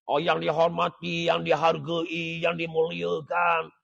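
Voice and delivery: slow, drawn-out ceremonial salutation; mock formal emcee. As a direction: list the honorifics in an exaggerated, level chant. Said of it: "Oh, jangan buat gitu". (gaya pengacara majlis yang meleret)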